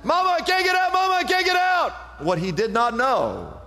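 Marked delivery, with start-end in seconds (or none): 0.0-1.9 put-on child calling out.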